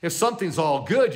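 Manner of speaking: ordinary talk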